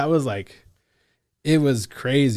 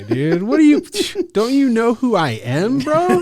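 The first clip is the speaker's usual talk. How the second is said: high, loud, incredulous squawk with a laugh; mock outrage; 14 semitones higher, 9 dB louder than usual. Caller insulted by the brush-off, hammed-up outrage. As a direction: play the offended big shot.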